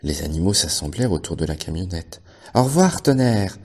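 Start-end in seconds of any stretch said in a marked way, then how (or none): 2.5-3.6 higher, louder farewell call; cheerful chorus call. A narrator's reading. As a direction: call out goodbye together. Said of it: "dirent-ils en chœur".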